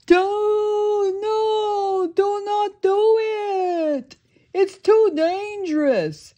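begging Patrick. Acted. Patrick begs his friend, high and drawn out. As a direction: beg him not to go in. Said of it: "Patrick ran up to SpongeBob and begged".

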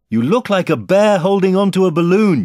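high, loud call. A narrator's reading. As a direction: shout the answer to someone overhead. Boy calls up to floating bear.

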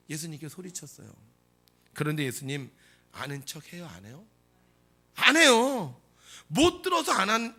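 normal talking voice

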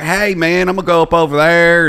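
drawn-out Southern guy, a little effeminate; effeminate Southern accent. Acted. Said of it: "like, an effeminate Southern accent".

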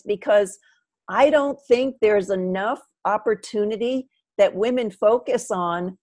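normal talking voice

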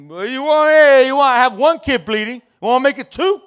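high, loud, strained threat; mad. Retold or imitated. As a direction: snap upward into an angry challenge. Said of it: "So now I'm mad".

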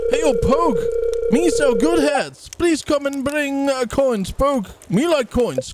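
high, squeaky caller on the phone. Acted. Salesman phoning to sell hats, squeaky. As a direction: pitch it way up, like a squeaky little salesman. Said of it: "You hear a squeaky voice".

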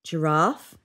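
usual speaking voice

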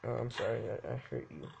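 hammed-up sorrowful low voice; deep mock remorse. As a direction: drop into a deep mumble.

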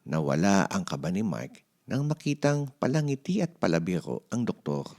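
ordinary delivery, nothing marked

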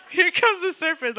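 high, loud lisping snake voice, laughing through it; lisping cartoon snake. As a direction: hiss it out like the Jungle Book snake, cracking up. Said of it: "Jungle Book with the lisp".

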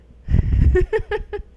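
loud, high laugh; burst of laughter. Real, not acted. Streamer cracking up at her own accent, loud laugh. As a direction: crack up loudly.

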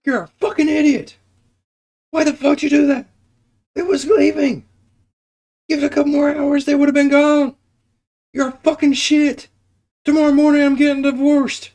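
high, loud screeching mock wife; angry wife. Friend voicing the furious wife, high shrill yelling. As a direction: shriek abuse at the husband, high pitched.

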